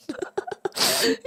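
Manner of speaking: high burst of laughter. Real, not acted. Laughs at chat joke.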